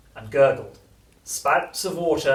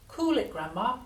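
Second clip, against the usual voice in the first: high grandson voice, not raised; unruffled storybook child; George reassuring Grandma; 10 semitones higher than usual. A narrator's reading. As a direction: answer the screaming lightly, pitched up. George answering in character.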